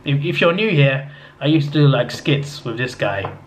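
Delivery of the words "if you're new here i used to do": ordinary talk, nothing marked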